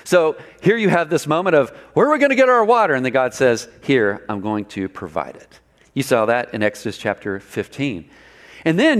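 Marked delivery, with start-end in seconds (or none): 1.9-2.9 high, fast, alarmed cry; panicked question. Retold or imitated. Wandering people panicking about where water comes from, fast and high. 3.8-5.6 calm reassurance. Retold or imitated. God answering the people calmly.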